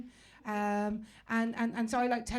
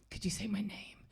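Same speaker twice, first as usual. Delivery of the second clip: mock-intimate whisper; hushed plea; 3 semitones lower, 4 dB quieter, slower than usual. Comedian begging a man to say her name, hushed whisper. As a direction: whisper the request as if begging a secret favour. Pleading with a stranger for French name.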